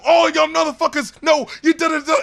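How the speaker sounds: high, loud imitating angry rapper; mock yell. Imitating the crew arguing in the studio, high mock yell. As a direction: bark it like a studio argument.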